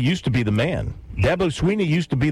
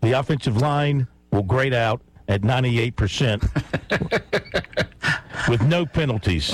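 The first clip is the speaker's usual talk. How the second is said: ordinary talk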